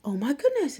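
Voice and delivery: high, loud, gushing delight; excited teacher. Retold or imitated. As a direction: squeal it out like thrilled news. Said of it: "she gets so excited".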